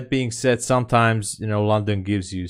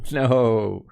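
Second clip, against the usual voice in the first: high, louder oh no of defeat; loud dismayed wail; 7 semitones higher, 6 dB louder, much slower than usual. Real, not acted. Trader watching the inevitable hit, loud high wail. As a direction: howl at the loss.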